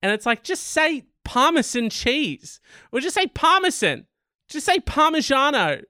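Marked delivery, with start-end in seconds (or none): 0.4-2.6 exasperated plea. Pretend. Demanding plain pronunciation. 3.0-5.8 high-pitched insistent demand; exasperated plea. Pretend. Host begging people to say it plainly, exasperated.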